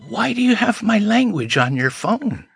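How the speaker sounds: high, moved disbelief; a tearful, wondering question; touched, amazed question. Retold or imitated. Stranger moved to tears hearing their mother tongue, high and amazed. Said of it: "they say things like".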